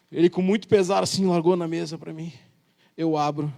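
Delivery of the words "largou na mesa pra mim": ordinary talk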